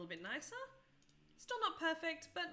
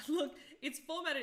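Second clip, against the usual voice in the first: laughing; 6 semitones higher, 7 dB louder than usual. Real, not acted. Laughs at an unexpected result.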